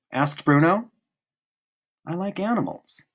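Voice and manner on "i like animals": softer, higher, gentle admission; a shy boy sharing a wish; hushed and gentle; quietly. Acted. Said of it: "said Schmoll quietly".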